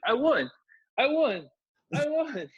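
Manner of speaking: high-pitched victory cry, imitated; hammed-up excited cheer; mock triumph. Acting out wrestler wrongly claiming victory.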